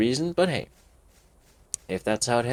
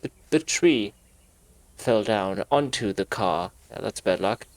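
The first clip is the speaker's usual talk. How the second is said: ordinary delivery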